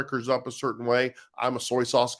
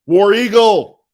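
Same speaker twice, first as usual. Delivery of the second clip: high, loud fan shout; rallying cheer; 8 semitones higher, 13 dB louder, slower than usual. Real, not acted. Greeting a fan watching the stream, loud rallying cheer. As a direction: belt it out like a team battle cry.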